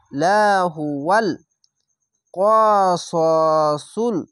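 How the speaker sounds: slow intoned লাহুয়াল কসসুল with a long held ending; Quran recitation. Shown as a demonstration. Teacher recites after spelling it out, slow chant. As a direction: chant it, stretching the last syllable. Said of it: "কফ জবর ক".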